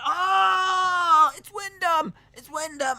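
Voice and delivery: very high, loud yelling; hammed-up fan scream of the name; mock crowd shriek. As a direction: squeal like fans losing their minds. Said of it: "and everyone's like".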